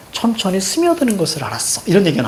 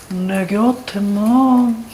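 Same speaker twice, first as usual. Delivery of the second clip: [name] impression; 3 semitones higher, much slower than usual. Teacher imitates [name] to the class, slow crooning. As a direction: drag it out like a ballad singer. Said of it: "[name] 다 흉내냈었는데".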